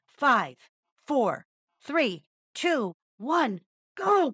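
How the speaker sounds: loud countdown, rising to a shout; crying out. Acted. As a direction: call the start of the race. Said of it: "cried the rooster".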